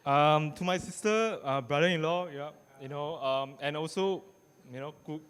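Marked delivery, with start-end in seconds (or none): none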